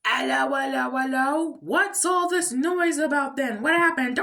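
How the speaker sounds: high-pitched, put-on questioning voice; high mock-inquiring character voice; acted cartoon character. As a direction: play a loud, high cartoon character demanding to know what's going on. Opening quote acted in a put-on voice.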